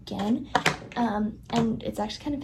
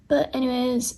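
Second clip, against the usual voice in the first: normal talking voice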